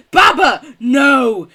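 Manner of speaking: loud, high cry of protest; outraged shout. Real, not acted. Reacting to Baba's pineapple line with a loud outraged shout. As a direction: yell in mock horror.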